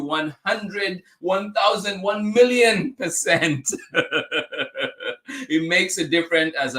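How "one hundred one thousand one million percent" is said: climbing higher with each bigger number; building enthusiasm. Real, not acted. Host agreeing with viewer about youth involvement, mounting enthusiasm. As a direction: escalate in pitch, drawing out the last figure into laughter.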